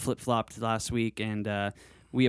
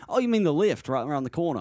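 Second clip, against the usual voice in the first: put-on higher-pitched Australian accent, suddenly getting it; Australian local; 4 semitones higher than usual. Australian man finally understanding the visitor, higher Australian accent. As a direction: catch on as a helpful Aussie. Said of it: "a guy in the lobby in Australia".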